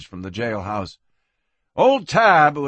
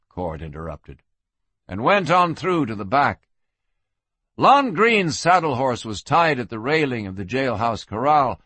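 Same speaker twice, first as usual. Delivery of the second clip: ordinary delivery, nothing marked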